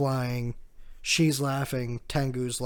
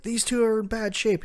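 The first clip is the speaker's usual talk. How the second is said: higher, urgent voice for Usopp; alarmed Usopp; 9 semitones higher, 7 dB louder than usual. Retold or imitated. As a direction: announce the wounded, pitched up and urgent.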